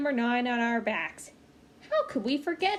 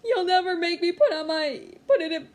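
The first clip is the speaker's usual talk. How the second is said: loud, high tearful shout; crying defiance; 6 semitones higher, 7 dB louder than usual. Acted. Boy refusing his mother, crying loudly. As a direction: yell it through sobs. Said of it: "I was crying".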